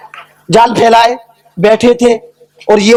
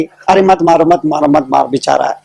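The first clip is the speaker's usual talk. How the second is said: pitying, pitched down and repeated; compassionate pleading; 7 semitones lower than usual. Acted. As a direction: plead gently for the poor fellow, low. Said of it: "रहम आ गई, दया आ गई".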